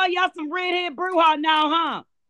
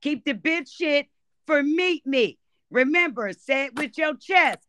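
ordinary talk, nothing marked

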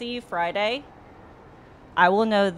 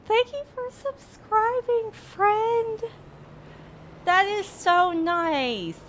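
very high-pitched, put-on British voice; bad British accent; 13 semitones higher, slower than usual. Streamer thanking a new subscriber, high mock British accent. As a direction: thank them in a drawn-out, badly faked British accent. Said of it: "a British accent. It sounds really bad".

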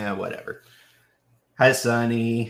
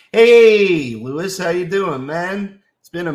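excited greeting; 8 semitones higher, 12 dB louder than usual. Real, not acted. Streamer spots a regular in the chat, excited greeting. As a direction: hail an arriving friend with gusto.

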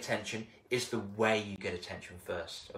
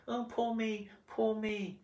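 higher, mock woe is me; self-pitying; 9 semitones higher, 4 dB quieter, slower than usual. Speaker mocking his old self-pity, higher and whiny. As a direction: whine for sympathy. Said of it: "I was being a bit".

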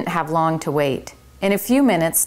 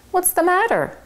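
high, fast-talking fussy little mouse; a busy little manager setting the rules; 12 semitones higher than usual. Acted. Tucker asks, alarmed.

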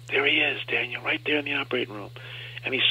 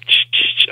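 unvoiced scraping imitation of a chisel on bone; chisel scraping noise; 10 dB louder, slower than usual. Patient recalling surgery, imitating the chisel scrape. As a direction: hiss out the chisel sound.

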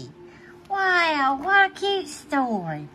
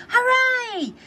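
high, excited cheer; a burst of kids' joy, pitched high; children cheering; 13 semitones higher than usual. Acted. Children cheering for the mountain trip, high and excited. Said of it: "cheer all the children".

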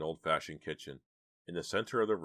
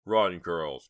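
loud shouted command; forceful yell of warning; urgent cry; 2 semitones higher, 11 dB louder than usual. A narrator's reading. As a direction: cry out to send them running. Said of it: "cried Chuck".

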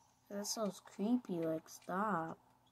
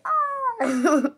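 high imitated yawn sound; 12 dB louder than usual. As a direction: do a quick yawn. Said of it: "I yawned".

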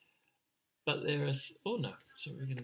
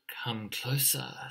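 softer, whispered invitation; sly snake; 5 dB quieter, slower than usual. Acted. Snake lures the emu chick closer, soft whisper. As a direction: lure them in with a hushed whisper. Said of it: "said Snake".

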